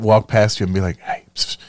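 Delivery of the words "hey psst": hushed psst from a street dealer; dealer whisper. Retold or imitated. Street dealer offering party favors in a whisper. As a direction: lean in and hiss it secretly.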